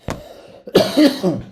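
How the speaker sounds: loud cough breaking the reading; real cough. Real, not acted. Reader interrupting the story, a loud real cough. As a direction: cough it out, then apologize. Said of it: "Sorry, guys".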